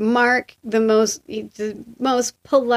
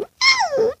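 very high, held-out squeal; high cartoonish squeak; mock youthful squeal. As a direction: imitate a squeaky showgirl noise. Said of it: "too old to be like".

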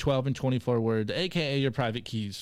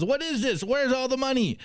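higher, puzzled mock complaint; mock confused user; 8 semitones higher than usual. Host voicing the FTX user in a meme, higher and puzzled. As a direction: play the duped FTX user asking for his cash. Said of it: "He goes".

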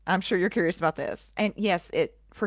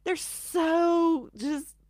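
high-pitched, helpless real laughter through the words; laughing; 11 semitones higher, slower than usual. Real, not acted. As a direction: apologise while cracking up, voice squeaking high. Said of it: "I'm sorry I'm laughing".

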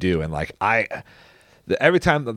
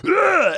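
high imitated monster shriek; short, high mock creature cry of disgust; troll shriek. Troll sees the milk and flees, high mock shriek. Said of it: "he goes".

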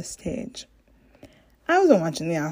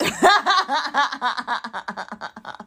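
long open laugh after a joke; real laugh at own joke; 6 semitones higher than usual. Real, not acted. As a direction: burst out laughing.

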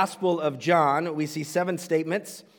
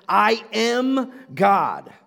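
I am God, slowed, louder, heavy on am; climactic proclamation; 4 semitones higher, 6 dB louder, much slower than usual. Acted. Preacher drives home Jesus' claim, slow and loud. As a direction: land I am God slowly, with weight. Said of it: "first and foremost".

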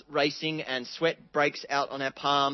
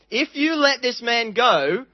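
louder, higher, slow demanding shout; shouting leaders; 6 semitones higher, 6 dB louder than usual. Retold or imitated. Leaders shouting at Pilate, raised and slow.

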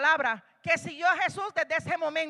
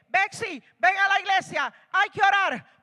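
calling out; 7 semitones higher, 9 dB louder than usual. Pretend. Calling a believer to church.

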